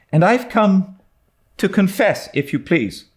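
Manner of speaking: put-on high earnest young girl steeling herself; nervous but determined; Anne, brave girl. A narrator's reading. Anne to Diana, earnest and high.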